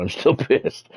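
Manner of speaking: a laugh breaking into still pissed. Real, not acted. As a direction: chuckle through the word pissed.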